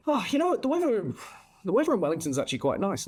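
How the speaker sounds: lightly voiced nonchalant mate; mock nonchalant Paul. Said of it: "he's sat there all nonchalant".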